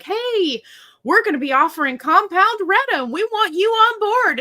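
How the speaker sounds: high, loud, put-on chirpy recruiter; mock over-eager sales voice; eager sales pitch. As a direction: pitch it high like a gushing company rep.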